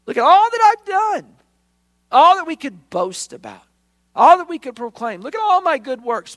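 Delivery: laughing, very high and loud self-praise; mock boasting. Believers bragging to God about their good works, mock-proud.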